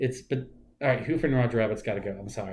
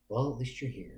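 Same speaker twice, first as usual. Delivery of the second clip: softer, resigned acceptance; resigned father; 2 semitones lower, 11 dB quieter than usual. Retold or imitated. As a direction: give in quietly to the dead son's return. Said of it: "but he's just like".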